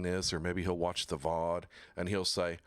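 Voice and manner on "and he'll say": normal talking voice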